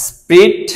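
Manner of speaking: slow, careful স্প্রিট with the rhyme stretched; drawn-out rhyme word. Shown as a demonstration. Teacher showing students the rhyme, slowly sounding out. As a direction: draw out স্প্রিট so its ending rings. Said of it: "তাহলে নিট".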